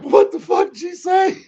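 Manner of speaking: very high, loud mock bewilderment; hammed-up incredulous squeal; baffled disbelief. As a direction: yelp it as if nothing made sense. Acts out failing to understand foreigners.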